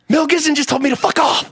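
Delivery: loud, high-pitched giddy imitation; smiling, thrilled. Friend turns around delighted after the snub, gleeful high exclaiming. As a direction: announce an insult as if it were a prize.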